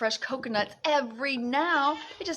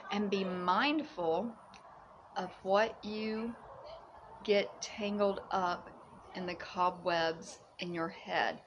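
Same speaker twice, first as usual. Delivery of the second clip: slow, softer, lower advice; slow mindful voice; 4 semitones lower, 7 dB quieter, slower than usual. Acted. Guiding viewers gently to mindfulness. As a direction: ease it out gently.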